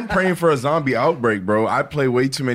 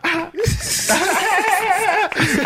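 long, high burst of laughter; high-pitched laugh. Real, not acted. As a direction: crack up laughing at your own joke. Cracking up at his own joke.

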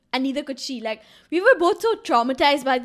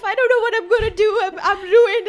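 high, wailing imitation of a crying friend; mock sobbing; 6 semitones higher, 4 dB louder than usual. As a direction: imitate her sobbing in exaggerated despair. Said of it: "She's crying, she's sobbing, and she's like".

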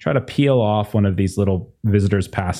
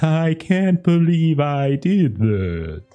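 higher, slow self-pitying moan; mortified receptionist; 6 semitones higher, much slower than usual. Acted. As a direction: mutter regrets while fleeing.

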